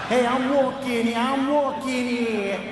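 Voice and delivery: loud, indignant New Yorker cat; high, loud street-tough cat; New York attitude cat. Acted. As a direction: holler it like a cocky New Yorker, last words drawn out. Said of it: "Every cat on this planet has a New York attitude".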